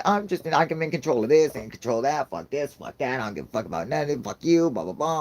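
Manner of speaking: put-on bossy toddler. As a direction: imitate a bratty rant. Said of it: "She's like".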